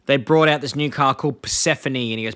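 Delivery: usual speaking voice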